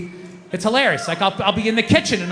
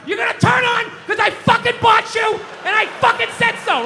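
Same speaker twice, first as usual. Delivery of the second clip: higher, hammed-up shout at the TV; mock yell; 12 semitones higher than usual. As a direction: scream at the TV like you own it. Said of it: "don't scream like that".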